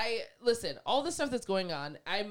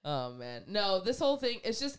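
ordinary talk

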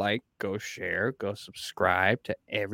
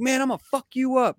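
imitating an angry guy; 12 semitones higher than usual. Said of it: "he was like".

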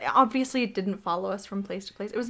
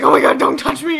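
louder, jumpy mock panic; flinching from a butterfly; startled fear; 5 semitones higher, 10 dB louder than usual. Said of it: "and I'm like".

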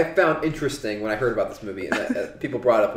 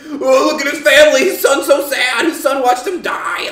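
much higher, loud, slow mock lament; mock melodrama; 11 semitones higher, 7 dB louder than usual. Mocking the movie's melodramatic family scene. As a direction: overplay the sad family scene.

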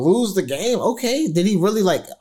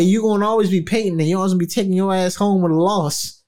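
ordinary talk

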